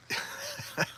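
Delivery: soft, stifled laugh; amused laugh. Real, not acted. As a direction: laugh quietly to yourself in anticipation. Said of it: "I just have to laugh".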